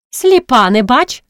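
high, impatient cry; mild shout. A narrator's reading. Horpyna to a girl who sees no light, shouting. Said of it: "крикнула Горпина".

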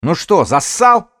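slow, high, loud dare; shouted taunt. Acted. Rival taunts the other to come out, slow loud jeer. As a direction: goad him at full voice.

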